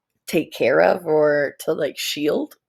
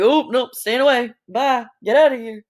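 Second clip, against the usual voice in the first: a hint of a startled bird backing off; lightly voiced bird, a little higher and louder; mock-spooked bird flying off; scared bird; 5 semitones higher, 3 dB louder than usual. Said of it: "they're like".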